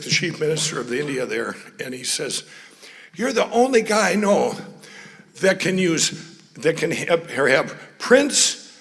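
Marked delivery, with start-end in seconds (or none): 3.2-8.8 rising higher and louder toward the end; building amused disbelief. Retold or imitated. Quoting the chief minister's amazement, building higher and louder. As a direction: build the list up to a loud high peak.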